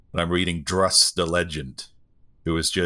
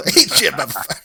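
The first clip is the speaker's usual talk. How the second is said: higher, louder laughing imitation; mock outburst; 9 semitones higher, 6 dB louder than usual. Co-host imagining it at parents' evening, mock loud blurt. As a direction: blurt it out loudly in jest.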